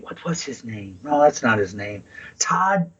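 whispering to himself while trying to recall a name; under his breath, searching memory; whispered to self. Real, not acted. To himself, hunting for a name, whispered. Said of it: "muttering while trying to remember".

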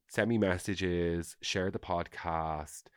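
very slow, drawn-out outro; leisurely slow sign-off. Real, not acted. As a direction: stretch each plea out.

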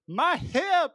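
high, squeaky cry of "my hip"; hammed-up injured old woman; imitating a hurt old woman. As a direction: mimic the old lady clutching her hip. Acting out a character's cry.

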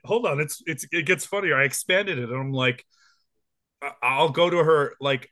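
usual speaking voice

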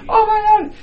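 high, loud excited shriek; excited squeal. Real, not acted. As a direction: shriek with delight. Excited realization.

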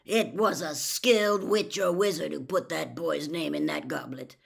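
loud, growled insistence; forceful, growled argument. A narrator's reading. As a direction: growl it out emphatically. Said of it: "growled Moody".

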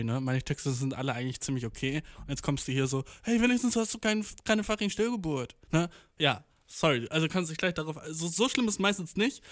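ordinary talk, nothing marked

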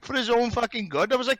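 ordinary delivery

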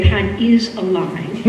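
normal talking voice

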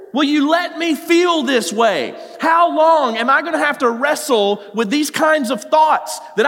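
high, loud pleading shout; anguished shouted prayer; crying out to God. Retold or imitated. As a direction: plead desperately, full force. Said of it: "he says".

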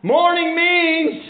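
high, ringing preacher's cry; exultant proclamation. Real, not acted. Preacher proclaiming what morning means, exultant cry. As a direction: soar up and proclaim it.

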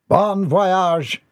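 high, cheery send-off; high-pitched jolly call; cheerful. A narrator's reading. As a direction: sing out a cheerful farewell. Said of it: "he said cheerfully".

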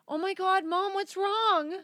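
high, slow imitation of her daughter's alarm; worried daughter. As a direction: mimic a child asking what's wrong. Daughter voiced in a story.

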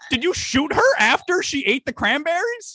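high-pitched incredulous outcry; shocked disbelief. Acted. Store owner confronting a customer over a shooting, shrill disbelief. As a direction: squeal the question in mock horror.

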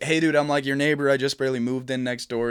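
loud call to a neighbor through a doorbell camera. Retold or imitated. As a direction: shout so the camera hears. Shouting at the Ring doorbell.

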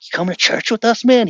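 high, loud, eager invitation; excited. Retold or imitated. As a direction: act out a thrilled teenager asking. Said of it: "He was excited".

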